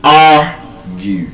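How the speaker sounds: strong AR, pause, lighter GUE in argue; stress on first syllable. Shown as a demonstration. Teacher proving the exception, argue broken up with first syllable stressed. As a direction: argue split in two, AR loud. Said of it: "on the first syllable".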